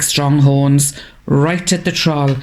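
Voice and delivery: ordinary delivery, nothing marked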